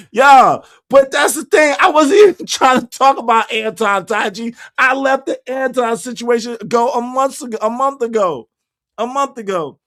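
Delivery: loud protest. Real, not acted. Streamer protesting to chat that he dropped the Anton topic, loud and laughing. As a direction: insist hard, half-laughing.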